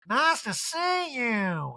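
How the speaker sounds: high, very slow mock Erica. Erica voiced in recap.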